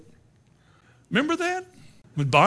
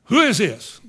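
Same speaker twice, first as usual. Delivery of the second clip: loud, raised question; demanding watchman; 4 semitones higher, 8 dB louder than usual. Retold or imitated. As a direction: challenge them like a watchman on his rounds. Watchman questions the people praying.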